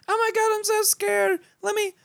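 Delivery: very high mock-damsel falsetto; mock a frightened date, squeaking in falsetto; scared girl impression. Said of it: "To get them to do the".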